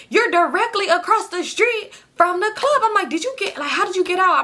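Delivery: high, incredulous questions; to the friend on the phone, high disbelief; stunned disbelief. Retold or imitated. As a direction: sound stunned that he got out.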